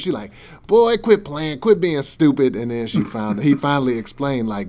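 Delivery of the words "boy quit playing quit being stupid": loud, high mock scolding; hammed-up coworker telling him to cut it out. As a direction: coworker on the phone scolding a supposed prank caller, loud and high. Coworker scolds caller again.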